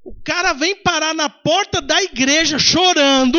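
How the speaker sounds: loud, high, slow preacher's amazement; emphatic exclamation. Real, not acted. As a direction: proclaim it loudly, stretching the last word. Preacher exclaims over the testimony.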